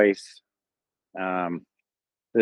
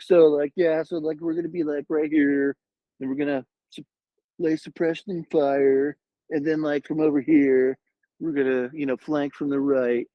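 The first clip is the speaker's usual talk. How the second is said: put-on higher, laid-back Southern California surfer accent; stretched-out Southern California accent full of likes; 7 semitones higher than usual. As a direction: mimic your younger self's SoCal accent, pitched up. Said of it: "Guess I was talking like that".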